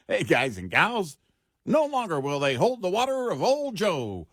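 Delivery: hammed-up old radio announcer; old newsreel. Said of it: "it was like".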